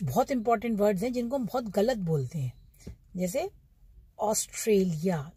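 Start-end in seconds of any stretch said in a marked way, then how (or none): 4.2-5.3 ऑस्ट्रेलिया said slowly, each syllable clear; careful model word. Shown as a demonstration. Teacher modelling a word for the class to repeat, slow and clear. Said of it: "repeat करिए आप मेरे पीछे पीछे".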